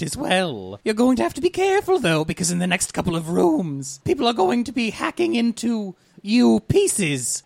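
high chirpy speaker-box host; bright squeaky sing-song welcome. Acted. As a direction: sing it out like a cheery intercom voice.

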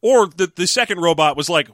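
ordinary delivery

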